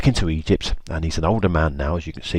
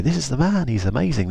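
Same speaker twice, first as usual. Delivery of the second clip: high, swooping mock excitement; pitched-up admiring gush; gushing report; 8 semitones higher than usual. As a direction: imitate excited girls telling father. Daughters gush to their father.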